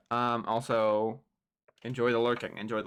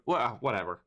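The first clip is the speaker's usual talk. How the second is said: usual speaking voice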